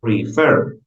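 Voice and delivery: "Prefer" with the weight on FER; stress on last syllable. Shown as a demonstration. Teacher giving an example of final stress, "Prefer" stressed on FER. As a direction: push the second syllable of "Prefer". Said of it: "en la última".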